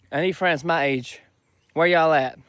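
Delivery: ordinary talk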